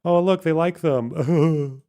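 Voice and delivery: slow, dopey mock of a clueless viewer; mock naive streamer voice. Mocking a streamer who watched hostage videos, slow dopey voice. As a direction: drag it out like you're seeing what you want to see. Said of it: "watched those people smiling and thought".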